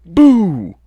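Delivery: huge loud high boo from hiding; shouted boo. Acted. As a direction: leap out and roar it. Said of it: "shouted Tirek".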